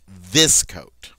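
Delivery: THIS drawn out slow and loud, coat falling; slow down and punch THIS; emphatic stress. Shown as a demonstration. Teacher translating the French example, slow heavy stress on THIS. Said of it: "I want to emphasize that it is this coat".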